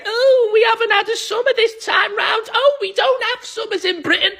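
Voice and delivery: high, whiny mimicry of complainers; mock them in a high-pitched moan; mocking moaners. Imitating British people moaning about summer, high mocking whine. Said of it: "Who was it saying".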